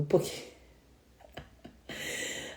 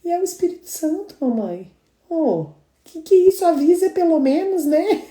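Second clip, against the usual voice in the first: excited retelling; 12 semitones higher, 4 dB louder than usual. Retold or imitated. Quoting daughter.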